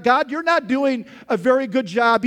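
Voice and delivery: loud, high, indignant complaint; griping at God. Retold or imitated. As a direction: scold God like a bad boss. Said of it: "He's basically telling God".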